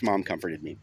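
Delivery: ordinary delivery, nothing marked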